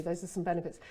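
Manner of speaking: normal talking voice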